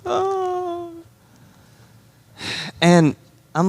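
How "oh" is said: high, soft, sustained mock wail; thin little "oh" of pretend crying; imitated child's crying. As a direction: imitate a little girl starting to cry. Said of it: "She just starts crying".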